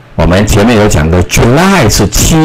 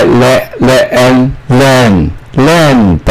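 slow blending; 3 semitones higher, much slower than usual. Shown as a demonstration. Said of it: "又来一个鼻音".

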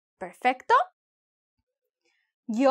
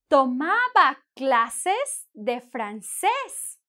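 slow dictation; much slower than usual. Shown as a demonstration. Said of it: "teacher dictating slowly".

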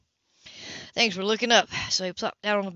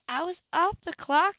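higher woman's voice, primly correcting; higher, prim social worker; prim reminder; 9 semitones higher than usual. A narrator's reading. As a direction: set him straight with crisp propriety. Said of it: "she reminded him".

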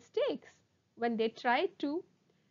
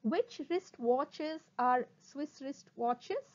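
slow, separated words, rising end; slow tongue twister; slower than usual. Shown as a demonstration. Teacher introduces a twister to students, slowly. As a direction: space out each word. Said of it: "first try saying them slowly".

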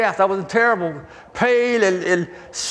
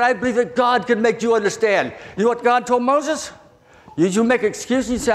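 ordinary talk

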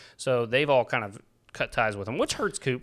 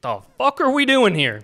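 loud, high feigned indignation; hammed-up appalled outburst; mock outrage; 10 semitones higher, 7 dB louder than usual. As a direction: explode in joking disbelief. Mock outrage at hot tea.

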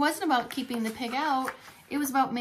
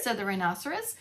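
ordinary delivery, nothing marked

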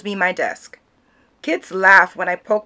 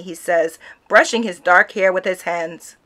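usual speaking voice

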